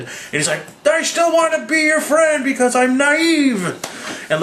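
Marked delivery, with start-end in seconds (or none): 0.9-3.6 very high, drawn-out cry; hammed-up earnest wail; mock naive pleading. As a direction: plead like an overwrought innocent. Voicing Thor pleading.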